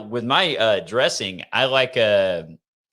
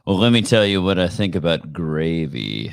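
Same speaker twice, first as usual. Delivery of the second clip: sexy voice; 6 semitones lower than usual. Pretend. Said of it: "talk in my sexy voice from now on".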